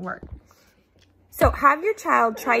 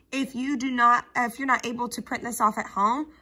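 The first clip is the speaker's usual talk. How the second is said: ordinary talk, nothing marked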